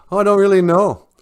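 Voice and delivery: animated animal voice admitting ignorance; character's admission. A narrator's reading. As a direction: own up loudly, pitched well up. Character voiced in told story.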